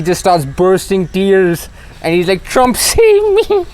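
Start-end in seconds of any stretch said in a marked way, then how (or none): 2.4-3.7 high, drawn-out tearful plea, hammed up; squeaky mock-crying wail, slow; mock voice cracking. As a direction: imitate someone bursting into tears, voice cracking upward. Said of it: "His, his voice is cracking and everything".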